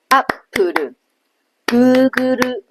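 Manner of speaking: アップル and グーグル tapped out in four even beats; counted beats. Shown as a demonstration. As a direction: give each mora a beat in アップル and グーグル. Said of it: "四个音节就是四拍".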